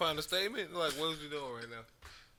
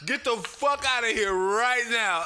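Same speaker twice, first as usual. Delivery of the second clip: mock furious yell, loud and high; hammed-up angry shout; 9 semitones higher, 10 dB louder than usual. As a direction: bark him off the lawn. Reacting to viral video, yelling.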